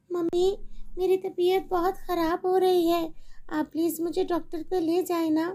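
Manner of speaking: put-on a little girl begging her mother for help; pleading little girl. Sick daughter.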